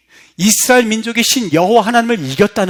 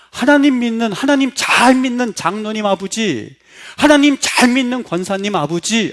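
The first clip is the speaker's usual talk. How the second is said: raised, pleading reproach, louder; bitter complaint; 4 semitones higher, 5 dB louder than usual. Retold or imitated. Children cry out at their churchgoing parents, raised and pleading.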